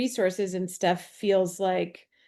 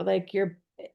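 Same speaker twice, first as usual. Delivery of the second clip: normal talking voice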